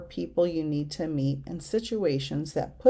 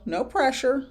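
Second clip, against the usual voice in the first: usual speaking voice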